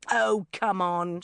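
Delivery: loud, slow, drawn-out objection; exasperated protest. A narrator's reading. Sharon brushing off Bridget's shushing, exasperated. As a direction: drag it out in protest.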